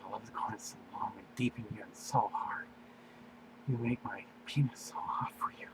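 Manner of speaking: soft unvoiced whisper. William murmuring to Daenerys in bed, whispering. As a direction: hush it right down as if close. Character speaks during love scene.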